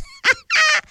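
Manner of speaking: very high squeal of laughter. Real, not acted. Host squeals with laughter at her own joke, very high.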